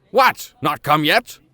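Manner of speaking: high, loud bark of a hot-tempered professor; impatient shout. Acted. Uncle calling his nephew to follow, shouting. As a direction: yell it across the house. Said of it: "shouting after me".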